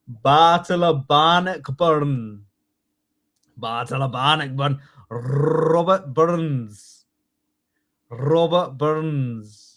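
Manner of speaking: slow, drawn-out Scottish with rolled r's; Scottish accent. Shown as a demonstration. Teacher modelling names for the class to repeat, Scottish accent. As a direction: roll every r in exaggerated Scottish. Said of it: "Give me your best Scottish accent".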